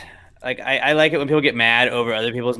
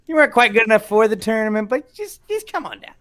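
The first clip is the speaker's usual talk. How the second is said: high, hammed-up folksy accent; mock country-bumpkin accent, pitched way up; put-on folksy accent; 10 semitones higher than usual. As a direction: do a squeaky consoling host in a hokey accent. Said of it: "I do that accent all the time".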